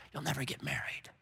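hushed, breathless lie into the ear; enemy's whisper. Acted. Enemy whispering lies to a woman, hushed and sly. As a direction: whisper it like a cruel secret. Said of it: "That's the whisper of the enemy".

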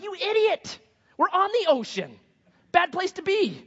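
high, incredulous mock yell at Jonah; exasperated sailors. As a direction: shout it high like panicked, fed-up sailors.

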